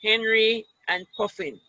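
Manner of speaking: lively picture-book read-aloud; children's story voice. Acted. Teacher reads picture book to class.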